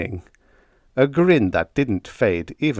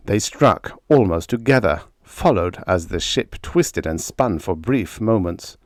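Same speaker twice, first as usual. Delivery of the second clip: usual speaking voice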